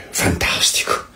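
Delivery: breathless whisper of delight; a soft whispered fantastico; whispered aside. Real, not acted. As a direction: murmur it to yourself in awe. Ironic aside after the gospel.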